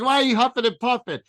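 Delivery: high, loud teasing voice; mocking girlfriend voice. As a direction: tease him for huffing. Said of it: "It's like".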